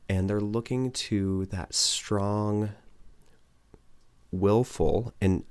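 ordinary talk, nothing marked

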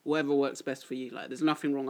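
ordinary talk

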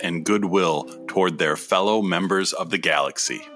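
low, measured storybook narration; grand booming space narration. A narrator's reading. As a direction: open the space saga like a storyteller. Continues audio drama narration.

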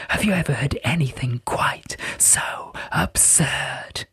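a sprite mocking in whispers; tinkly bell voice. A narrator's reading. Pyrope mocking the scholar.

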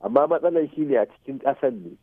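ordinary talk, nothing marked

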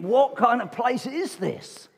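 a newcomer in church, puzzled by the reading, asking in disbelief; baffled newcomer. Retold or imitated. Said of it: "You think".

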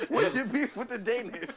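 high-pitched words through laughter; helpless laughter spilling into a drawn-out question; laughing question. Real, not acted. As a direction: ask it while cracking up. Laughing at co-host's Danish jab.

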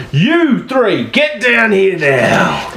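high-pitched, drawn-out order from a guard; guard's command. Acted. Guard ordering three prisoners down, commanding call. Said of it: "a guard walks towards you and says".

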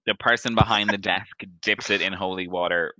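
ordinary delivery

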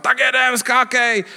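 high-pitched mock shout; imitated yelling. Skydivers urging a first-timer out of the plane, imitated yelling. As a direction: call out like a crew urging a jump. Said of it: "všichni začnou řvát".

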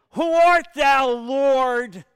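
loud, high cry from the ground; startled shout to the Lord; Saul crying out. Acted. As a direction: call out in awe, slow. Blinded Saul cries out.

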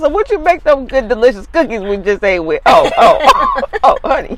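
high, giggling teasing; laughing tease. Real, not acted. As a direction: tease her through laughter. Teasing laugh over honey.

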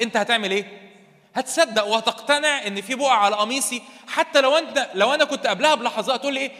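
loud, high, driving sermon voice; fiery preaching. Acted. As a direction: push the point home to the congregation. Preaching at full force. (وعظ بحماس وصوت عالي)

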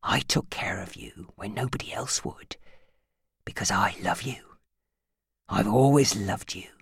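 soft possessive whisper. A narrator's reading. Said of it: "he stroked her hair".

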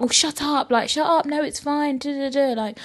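high-pitched scolding reassurance, imitated; insistent friend. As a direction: imitate a friend brushing off the doubts. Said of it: "she was like".

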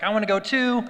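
put-on three-year-old son. Son asking dad to come hiking, mock little-kid eagerness. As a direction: lightly imitate an excited little boy. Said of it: "my three-year-old son".

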